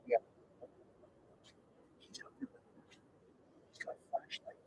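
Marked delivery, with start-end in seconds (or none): 2.0-4.6 slow eerie whisper; hushed, slow storyteller whisper. Real, not acted. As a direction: whisper it slowly like a ghost story. Spooky story, neighbor at night.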